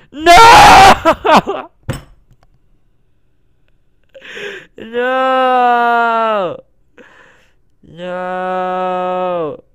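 real gamer heartbreak, fading; anguished cry. Real, not acted. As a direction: cry out in despair. The Pokemon escaped.

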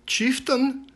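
put-on high, bright girl calling the chief; a young girl alerting the chief; Helga's voice. A narrator's reading. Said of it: "Helga said".

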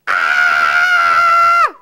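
loud low startled cry; frightened shout. Acted. Princess frightened by frog.

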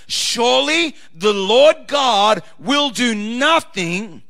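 ordinary delivery

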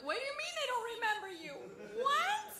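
high, disbelieving outcry; shocked disbelief. Retold or imitated. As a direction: squeal in disbelief. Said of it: "and I'm like".

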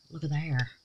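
ordinary talk, nothing marked

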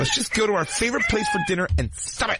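high coaxing voice; high mock sweet-talk. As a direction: coax him to dinner. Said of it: "mock couple argument".

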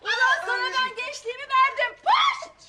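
high, loud raging outburst; furious shouting. Acted. Wife rages at husband over lost youth, furious shouting. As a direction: rage at him, shrieking the final insult.

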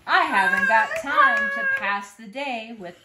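lightly voiced self-important truck; busy dump truck. A narrator's reading. Dump truck brushes off the duck, mildly blustering. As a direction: push through it emphatically, as a busy truck.

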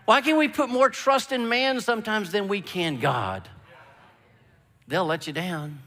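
ordinary talk

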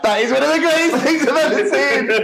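high, laughing enthusiasm; squealing, amused praise; gleeful delight. Retold or imitated. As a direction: crow with delight at a friend's disaster. Gleeful reply to outraged friend.

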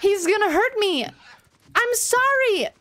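begging not to be hurt; pleading. Real, not acted. Pleading while under threat.

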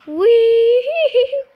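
high, loud gleeful shout; excited whee. Pretend. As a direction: shout it like a ride. Toy flying again.

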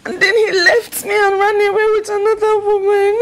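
loud, tearful lament; noisy, sobbing outburst; wailing. Acted. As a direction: cry it out loudly between sobs. Jilted bride wails about him leaving.

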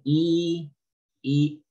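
English I misread as a long Spanish "y"; "y" pronounced like the Spanish vowel; read as Spanish. Retold or imitated. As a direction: say "y" as a drawn-out Spanish ee. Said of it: "como si fuera español".

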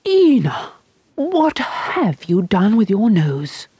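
alarmed at her daughter's face; horrified mother. A narrator's reading. Mother to Ina, horrified at the long nose. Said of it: "simply horrified".

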